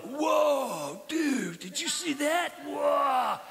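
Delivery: high, shouted surfer-dude awe; wide-eyed "whoa dude" astonishment; amazed disciples. Acted. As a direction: gasp it out like stunned fishermen. Disciples amazed at calmed storm.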